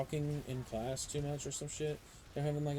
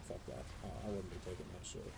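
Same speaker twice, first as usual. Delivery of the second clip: muttered; 6 semitones lower, 9 dB quieter, faster than usual. Real, not acted. Muttered aside.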